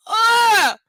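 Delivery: a sudden loud shriek of disgust. Real, not acted. Reader shrieks at the creepy line, disgusted shriek.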